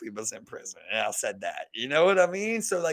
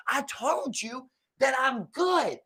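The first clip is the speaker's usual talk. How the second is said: high-pitched, drawn-out mock annoyance; insistent slow retort, pitched up; exasperated refusal; 7 semitones higher, much slower than usual. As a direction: imitate a fed-up customer, stretching each word slowly. Annoyed customer refusing, retold.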